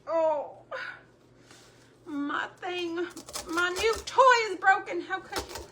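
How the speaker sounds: mock whine. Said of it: "I was like".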